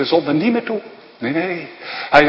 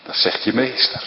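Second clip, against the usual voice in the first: normal talking voice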